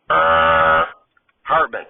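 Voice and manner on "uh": low, droning imitation of a game-show buzzer; long monotone voiced buzzer; wrong-answer buzzer. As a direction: buzz "errr" on one low note.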